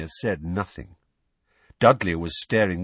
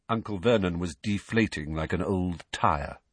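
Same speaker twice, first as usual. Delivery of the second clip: plain reading voice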